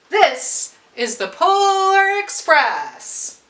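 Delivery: slow, loud and high, every word weighed; grand announcement. Acted. Conductor proudly naming his train to the boy, slow grand announcement. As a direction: announce the train's name with pride.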